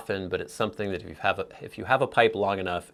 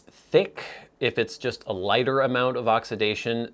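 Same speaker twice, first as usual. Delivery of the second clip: ordinary delivery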